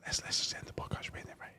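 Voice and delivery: unvoiced whispered sign-off; whispering. Real, not acted. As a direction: whisper the closing words. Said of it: "You started whispering".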